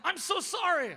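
flustered apology. Retold or imitated. Stranger apologising to the pastor he disturbed, flustered. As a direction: imitate an embarrassed stranger.

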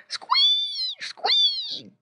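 very high-pitched pig squeal; imitated shrieking piglet. A narrator's reading. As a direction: squeal twice like a little pig. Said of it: "grunted Squinty".

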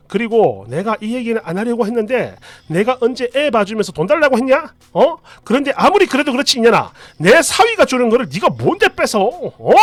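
acted indignant mother's rant; angry outburst. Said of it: "이렇게 버럭버럭 하시더니".

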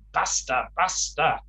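put-on a mildly cross "Basta, basta" to the children; daughter-in-law telling her children to stop, mildly cross; slightly angry. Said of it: "in a slightly angry voice".

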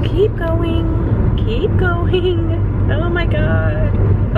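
high, slow pleading with the car; urging the car on. Real, not acted. Driver coaxing her nearly empty car, pleading. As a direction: beg the car to keep going, drawn out.

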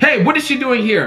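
mom yelling. Acted. Mom catches her son with a girl, mild yell. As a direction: call out like an annoyed mother. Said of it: "his mom yells".

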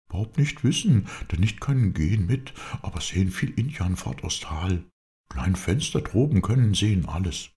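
high, lively character voice; Bob, eager helper. A narrator's reading. Bob tells his master how he found the tree, high and lively.

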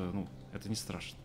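ordinary talk, nothing marked

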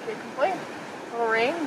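ordinary talk, nothing marked

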